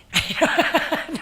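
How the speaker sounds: a loud high burst of laughter; real laugh. Real, not acted. Laughing at her own past self.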